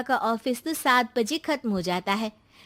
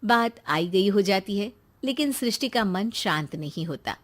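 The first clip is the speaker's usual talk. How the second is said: usual speaking voice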